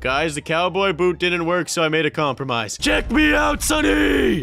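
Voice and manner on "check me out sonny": much higher, louder show-off call; hyped yell; excited shout. Real, not acted. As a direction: show off the new outfit loud. Showing off outfit loudly.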